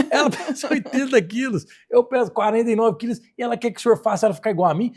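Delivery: loud, high, laughing through the words; laughing disbelief. Retold or imitated. Sister mocking her twin's wish to the doctor, laughing. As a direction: scoff with a laugh at the absurd request.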